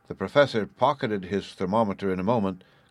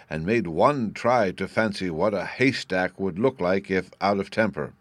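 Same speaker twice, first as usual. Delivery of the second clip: plain reading voice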